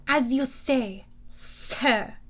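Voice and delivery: put-on grudging French-accented deference. A narrator's reading. Thorne yielding to Luke while glaring, French accent. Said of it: "Doctor Thorne glared at Luke".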